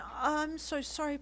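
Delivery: put-on a high, urgent plea to a crying girlfriend; pleading. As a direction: beg her to forgive you. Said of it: "Deku pleaded".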